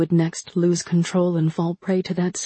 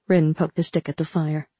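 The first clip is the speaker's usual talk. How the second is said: plain reading voice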